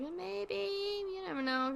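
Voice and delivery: high, soft, drawn-out singsong; musing mock hopefulness. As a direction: float it up softly, as a playful hunch. Voicing own earlier hunch.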